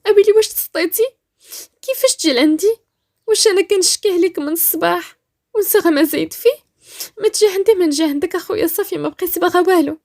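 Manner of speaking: high, loud incredulous outburst; alarmed protest. Acted. Rania forbids Badr from coming over, alarmed. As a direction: protest in disbelief.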